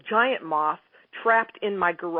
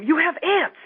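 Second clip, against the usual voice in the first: higher, alarmed little cry of dismay; mock fearful exclaim; 9 semitones higher, slower than usual. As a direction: imitate people startled by ants. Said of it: "with a bit of fear in their voice".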